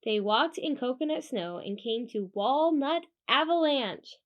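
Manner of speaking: usual speaking voice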